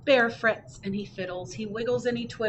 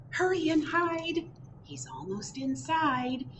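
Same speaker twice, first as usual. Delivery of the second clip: urgent warning; 9 semitones higher than usual. A narrator's reading. Wren warning the friends.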